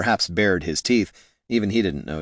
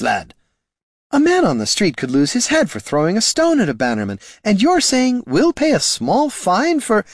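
put-on higher, louder worried young voice; anxious priest; 9 semitones higher, 4 dB louder than usual. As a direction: fret and protest, higher than usual. Niln's high anxious voice.

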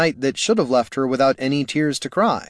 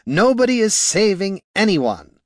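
higher, irritated wizard's bark; 8 semitones higher, 4 dB louder than usual. A narrator's reading. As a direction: bark it to crush all hope. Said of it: "barked the wizard".

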